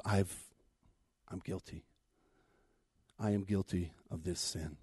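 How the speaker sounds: low, soft, halting admission; hushed confession. Acted. David faced by the man of God, hushed confession. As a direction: admit it quietly, voice dropped.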